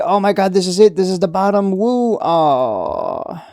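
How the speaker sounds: whooping mock celebration over the bottom; hammed-up excitement. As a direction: ham up a trader who thinks he timed the bottom. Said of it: "the cycle repeats".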